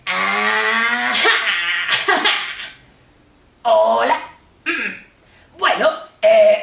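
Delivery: playful sock puppet character. Acted. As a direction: give the sock puppet its own voice. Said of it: "Yo acá tengo un títere de calcetín".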